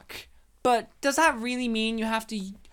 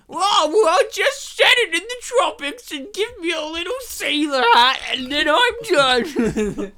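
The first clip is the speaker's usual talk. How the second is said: put-on high, loud goofy man-child voice; hammed-up whine sliding into laughter; Adam Sandler; 7 semitones higher, 6 dB louder than usual. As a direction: play a silly overgrown kid, loud and high. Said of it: "they're like, "But Sandler".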